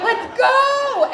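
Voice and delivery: high, drawn-out loud holler; rallying yell. Retold or imitated. Patient grabbing her IV and charging to surgery, rallying yell. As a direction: holler it like a battle cry.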